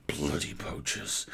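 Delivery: low, hushed angry growl. A narrator's reading. Elf growling at the poachers, low and angry. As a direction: growl it under your breath. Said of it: "he growled".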